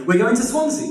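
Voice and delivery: a touch of excited husband; eager announcement. Retold or imitated. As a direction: announce it to his wife like good news. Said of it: "So he says to his wife".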